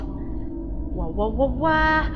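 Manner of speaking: ordinary talk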